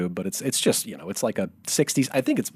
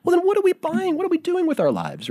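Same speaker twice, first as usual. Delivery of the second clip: much higher, loud rhetorical cry; exasperated outburst; 4 dB louder than usual. Real, not acted. Husband marvels at what they kept, very high exasperated cry. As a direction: throw your hands up vocally, very high. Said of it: "rhetorical outburst".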